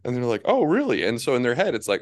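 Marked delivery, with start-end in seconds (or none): 0.4-1.0 high, loud imitated disbelief; hammed-up high oh really; mock surprise. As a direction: squeak it out like a stunned donor. Donor surprised at answer.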